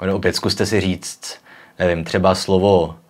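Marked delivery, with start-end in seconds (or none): none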